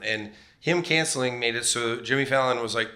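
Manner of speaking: ordinary talk, nothing marked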